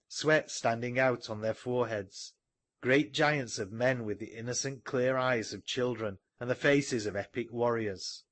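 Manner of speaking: ordinary delivery, nothing marked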